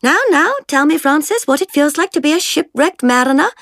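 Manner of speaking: arch, playful mockery of a furious girl; mock scolding. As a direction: scold her like a sulky toddler. Jemima mocking angry Francis.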